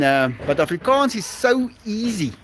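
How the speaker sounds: usual speaking voice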